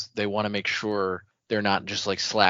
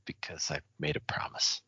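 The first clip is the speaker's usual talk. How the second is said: hushed secret-keeping whisper; 6 semitones lower, 9 dB quieter than usual. Retold or imitated. Khalen confessing he keeps a promise, whispered. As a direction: breathe it like a secret you must keep.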